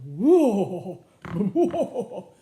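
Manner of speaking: loud, drawn-out bellowing roar; walrus bellow. Shown as a demonstration. Reader showing what a walrus might sound like, bellowing. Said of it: "that's the sound he might make".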